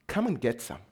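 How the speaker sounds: Jesus quietly inviting; soft invitation. Retold or imitated. Jesus inviting his disciples, soft and low. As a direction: offer it gently, almost under the breath. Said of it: "He's going".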